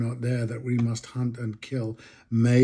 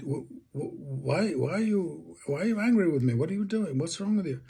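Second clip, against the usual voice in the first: higher-pitched, wavering voice of puzzled innocence; bewildered Churchill; 5 semitones higher than usual. Retold or imitated. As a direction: act the baffled great man, swooping up on each question. Retells Churchill's puzzled reply to secretary.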